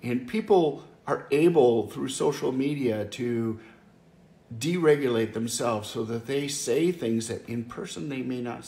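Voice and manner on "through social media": ordinary delivery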